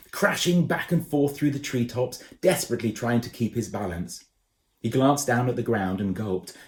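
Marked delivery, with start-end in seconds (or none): none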